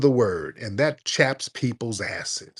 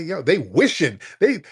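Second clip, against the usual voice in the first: normal talking voice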